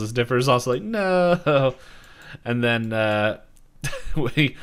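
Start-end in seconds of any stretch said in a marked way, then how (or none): 0.8-1.8 high, loud, drawn-out wail; hammed-up cry of protest; mock dismay. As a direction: cry out in play-acted despair. Acting Dipper dreading more sequels.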